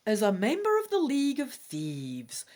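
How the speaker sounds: loud, projected adventure intro; gamebook read-aloud. Acted. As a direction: announce the quest lore with weight. Reads the combat introduction aloud.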